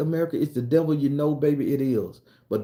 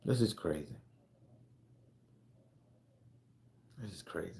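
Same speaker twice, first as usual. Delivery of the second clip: softer each time, sinking low; quiet stunned repeat; fading disbelief; 7 semitones lower, 11 dB quieter than usual. Real, not acted. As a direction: let it die away in disbelief. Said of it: "I said".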